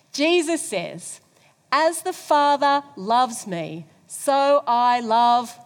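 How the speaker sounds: congregation reading aloud slowly; slow loud group recitation; many voices in unison. Real, not acted. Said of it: "let's read it together".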